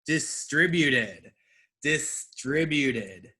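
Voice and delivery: distributed drawn out slowly, syllable by syllable; teacher's slow model; slow model word. Shown as a demonstration. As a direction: say distributed slowly and clearly for learners. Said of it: "focus on my emphasis".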